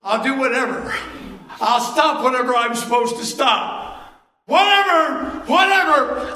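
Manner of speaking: loud, high cry of giving in; shouted surrender. Retold or imitated. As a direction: shout whatever like giving everything up. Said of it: "you end up saying".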